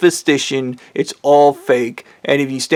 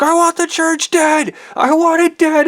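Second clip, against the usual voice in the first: very high, strained scream; retold screaming. Speaker to the wives against the church, screaming. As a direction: shriek it as a furious demand. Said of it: "I remember screaming".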